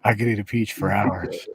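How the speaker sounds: hammed-up villain line, loud on peach; Face/Off movie quote. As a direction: relish each word like a movie villain. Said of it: "I still quote a line from that movie".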